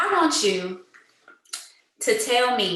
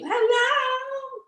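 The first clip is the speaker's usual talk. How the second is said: high hello. Real, not acted. Greeting new caller.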